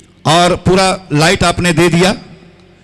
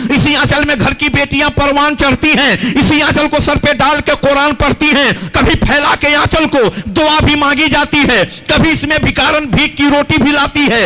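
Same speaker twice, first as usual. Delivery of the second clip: high, impassioned stage recitation; poem recitation; 10 semitones higher than usual. Acted. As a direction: declaim each line to the hall.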